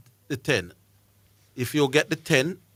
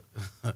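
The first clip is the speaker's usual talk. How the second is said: soft laugh; 3 semitones lower, 9 dB quieter than usual. Real, not acted. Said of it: "Why am I laughing?".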